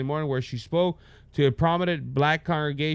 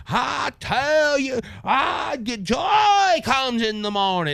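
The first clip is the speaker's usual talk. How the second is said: much higher, slow, shouted preacher cadence; mocking a politician's preacher voice, shouting; mock Southern preacher; 10 semitones higher, 3 dB louder, slower than usual. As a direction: ham up a fiery Southern church preacher. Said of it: "mimicking a preacher".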